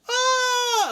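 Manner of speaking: very high, held "ah"; hammed-up shriek of fright; mock frightened scream. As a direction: imitate a scared high scream. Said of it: "I'm not gonna".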